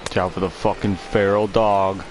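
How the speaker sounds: deep hyped-up shout; loud. Real, not acted. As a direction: pump it up loudly. Player hyped for closer.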